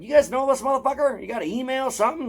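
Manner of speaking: high-pitched, worked-up re-enactment; high retold plea for information; retold exasperated questioning. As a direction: act out pestering the vendors, voice way up. Pressing vendors for info, retold.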